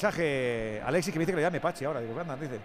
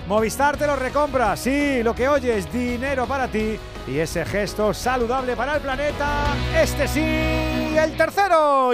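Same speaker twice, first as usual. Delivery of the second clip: upbeat, higher sponsor read; 5 semitones higher, 4 dB louder, slower than usual. Acted. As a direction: sell it over the music. Sponsor ad read over music.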